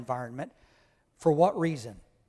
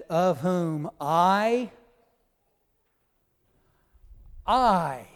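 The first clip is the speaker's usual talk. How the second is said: slow, loud, long-held "I"; very emphatic; 7 dB louder, much slower than usual. Acted. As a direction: hammer "I" twice with a big pause between. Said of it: "Paul is very emphatic here".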